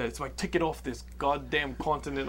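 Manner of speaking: badly faked Blood Diamond accent; shitty movie accent. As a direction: attempt the film's accent and do it poorly. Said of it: "Can you do the accent?".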